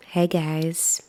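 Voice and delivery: low, put-on bass voice; deep voice. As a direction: push the voice down into the bass.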